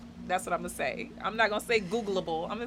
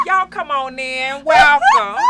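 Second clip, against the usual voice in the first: loud Southern hostess welcome; Southern accent; 4 semitones higher, 13 dB louder, slower than usual. Acted. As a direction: drawl out a welcoming Southern hello. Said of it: "real Southern on our intro".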